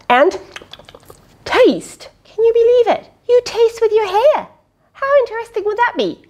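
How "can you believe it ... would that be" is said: high, amazed and eager; pitched-up storybook amazement; excited wonder. Acted. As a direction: wow the child with this fact. Excited reading to child.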